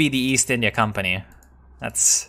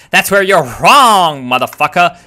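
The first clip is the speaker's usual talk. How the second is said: loud, higher triumphant shout; sudden loud taunt; gamer yell; 5 semitones higher, 8 dB louder than usual. Real, not acted. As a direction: bark it at the screen like you caught them out. Gamer yelling at screen.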